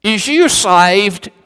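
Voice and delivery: put-on high, slow Cockney little-girl voice. Cockney girl asks the bishop about salvation, high Cockney accent. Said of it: "in that Cockney accent".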